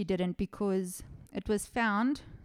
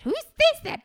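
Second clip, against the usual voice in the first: much higher and louder, almost a shriek; shrieking disbelief; 9 dB louder than usual. Real, not acted. Host repeating the question to listeners, shrieking disbelief. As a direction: push the disbelief right over the top.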